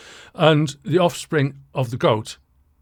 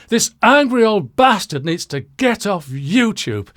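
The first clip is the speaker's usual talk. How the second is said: louder, raised mock-indignant commenter; angry commenter voice; 5 semitones higher, 5 dB louder than usual. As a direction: act out an outraged viewer's complaint. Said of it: "that's how he would say it".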